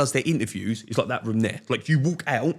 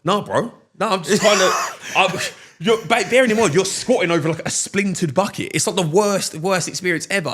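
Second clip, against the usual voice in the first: excited; 6 semitones higher, 6 dB louder than usual. Real, not acted. Reliving the toilet ordeal.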